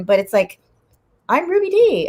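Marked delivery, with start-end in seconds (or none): 1.3-2.1 high-pitched, deliberately limited celebrity impression; Ruby Dee. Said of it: "that's my best impression".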